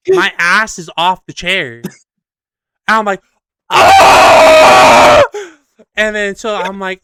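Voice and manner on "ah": very high, loud "ah"; long, full-volume ride shriek; mock terrified scream. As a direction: scream as if the floor just dropped. Acts out the scream while falling.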